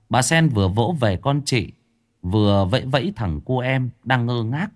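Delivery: usual speaking voice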